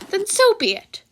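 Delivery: a carefree little principal; Nezu voice. Acted.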